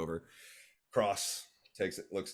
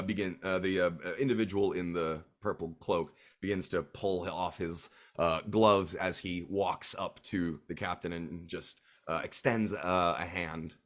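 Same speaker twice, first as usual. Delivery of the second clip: ordinary talk, nothing marked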